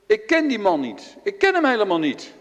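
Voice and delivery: loud, high panicked denial; felle ontkenning. Acted. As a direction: deny it loudly and desperately. Petrus ontkent fel. (luid en hoog, paniekerig ontkennend)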